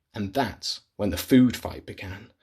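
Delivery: plain reading voice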